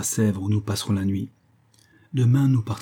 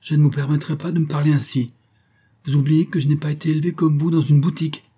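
higher, forceful haughty rebuke; offended wife, raised voice; tragic indignation; 6 semitones higher, 5 dB louder than usual. A narrator's reading. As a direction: draw yourself up and rebuke. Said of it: "Elle se dressa, tragique".

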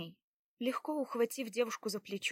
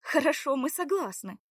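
eager agreement; 6 semitones higher, 6 dB louder than usual. A narrator's reading. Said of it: "закивала Белава".

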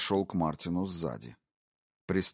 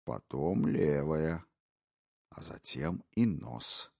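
slow menace; slower than usual. Acted. Intruder listing his cuts, slow and cold. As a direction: draw out each word coldly. Brogan continues.

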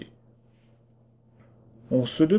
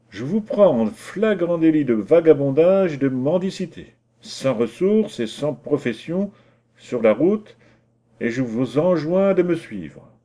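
official arrest; 5 semitones higher, 4 dB louder than usual. Acted. Gendarme arrests vagabond.

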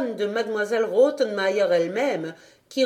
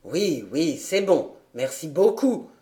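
lower, light male servant voice; grumbling butler; 4 semitones lower than usual. A narrator's reading. Butler answering after colliding with the governess, lower. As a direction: mutter it rubbing a sore head.

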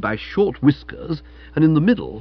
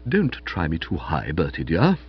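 low, soft, restrained warning; gently; 8 semitones lower, 3 dB quieter than usual. A narrator's reading. Aunt cautioning her nephew not to push her, gently. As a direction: warn him softly, holding back. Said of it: "she said gently".

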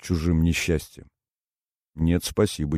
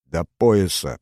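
plain reading voice

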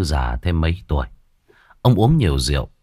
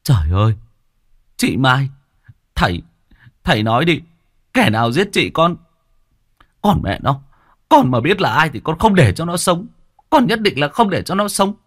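wailing outcry; 8 semitones higher than usual. Acted. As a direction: howl out staged grief and vengeance. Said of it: "nó gào lên". (gào lên giả vờ đau thương)

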